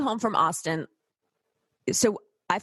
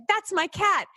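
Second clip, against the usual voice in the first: higher, sudden claim of the cat; startled owner claim; 9 semitones higher than usual. Retold or imitated. Passenger imitated leaping up to claim his lost cat, higher. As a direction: jump up and blurt it out. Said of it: "He's like".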